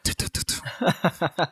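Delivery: slow whispered tut-tut clicks; tongue click. Retold or imitated. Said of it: "was there that noise, like".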